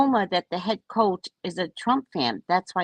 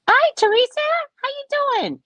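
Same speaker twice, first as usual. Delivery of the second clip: high, put-on sweet greeting; mocking flirty voice; 4 dB louder than usual. Imitating the flirt who greeted Teresa, high and sugary. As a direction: mimic her fake-friendly hello. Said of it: "That's what she said out her mouth".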